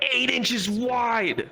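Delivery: high, drawn-out mock astonishment; stretched, high-pitched amazement; amazed friend. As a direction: imitate a friend marveling, stretching the words. Quoting friends amazed at their find.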